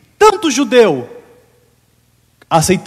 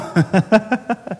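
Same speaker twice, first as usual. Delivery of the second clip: soft laugh; 7 dB quieter than usual. Real, not acted. Laughs at own story.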